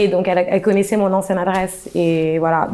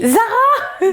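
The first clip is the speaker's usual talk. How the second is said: surprised. Retold or imitated. Meeting her ex by surprise.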